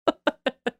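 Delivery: short high-pitched laugh; a quick laugh breaking up high. Real, not acted. Laughs at own joke.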